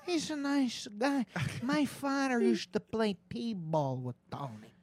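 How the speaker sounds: high, slow Sebastian voice; slow and high, Chicago guy; Sebastian impression. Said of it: "I'll do Sebastian".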